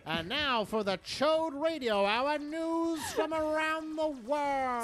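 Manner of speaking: slow, high announcer voice; old-time radio announcer voice; 1940s radio announcer. Pretend. Radio news intro.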